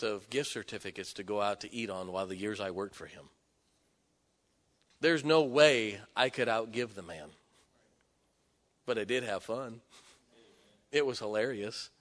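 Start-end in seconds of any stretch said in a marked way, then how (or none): none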